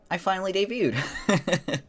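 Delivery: excited. Real, not acted. Excited debut announcement.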